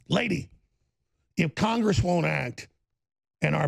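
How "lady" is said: ordinary talk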